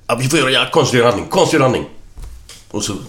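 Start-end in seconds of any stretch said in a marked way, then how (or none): 0.1-1.8 high, louder urgent call; rally everyone in a mock panic; urgent alarm. Fishermen over a drowned man, urgent.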